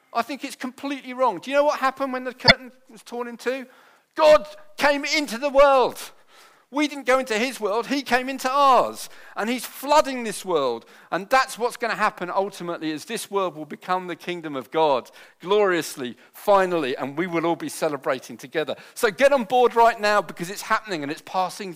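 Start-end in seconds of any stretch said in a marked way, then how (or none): 1.4-10.9 high, loud preacher's climax; preaching at full force. Acted. 18.9-20.2 loud, high call to join; rally the congregation. Acted.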